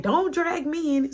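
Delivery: laughing plea to stay out of it; playful protest. Retold or imitated.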